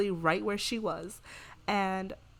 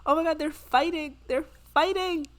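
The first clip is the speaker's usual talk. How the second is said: much higher and louder, thrilled; high excited oh my God; excited shock; 8 semitones higher, 7 dB louder than usual. Real, not acted. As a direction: cry out at the brawl. Watching the fight.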